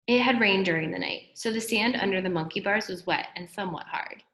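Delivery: ordinary delivery, nothing marked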